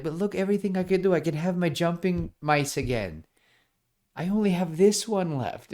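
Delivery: high, fast eager plea; excited high voice; eager Bobinsky. Acted. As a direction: rush to persuade.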